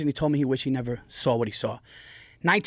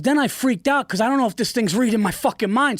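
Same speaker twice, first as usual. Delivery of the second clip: higher and louder, worked up; telling it like the fear is back; retold panic; 8 semitones higher, 3 dB louder than usual. Father reliving his UFO panic, worked up. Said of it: "he goes".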